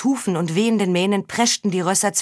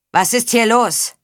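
slow loud demanding call; 4 semitones higher, 5 dB louder than usual. Acted. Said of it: "rief jemand".

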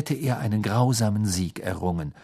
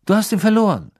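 zweifelnd; 11 semitones higher, 7 dB louder, faster than usual. A narrator's reading. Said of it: "wiederholte Mister Diggory zweifelnd".

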